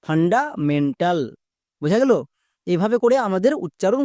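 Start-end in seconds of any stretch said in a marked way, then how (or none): 0.1-1.2 fundamental said slowly as one joined word; now blend fundamental together, still slow; careful model reading of fundamental; slow full word. Shown as a demonstration. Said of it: "তাহলে পুরোটা কি দাঁড়ালো?".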